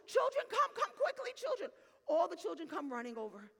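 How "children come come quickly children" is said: high, loud summoning cry; calling out urgently. Retold or imitated. Woman calls all the children over, urgent high shout. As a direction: imitate calling children from afar, pitched way up. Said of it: "She calls all the chil".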